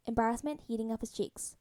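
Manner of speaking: usual speaking voice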